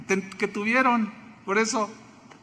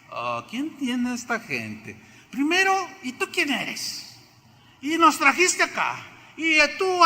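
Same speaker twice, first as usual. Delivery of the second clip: a lightly voiced grumbling Israelite, higher; complaining crowd; 8 semitones higher than usual. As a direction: toss out the blame in a mock whine.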